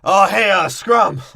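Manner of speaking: mock Gibbs call. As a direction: call out to Scrum like Gibbs, overplayed. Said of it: "Gibbs is like".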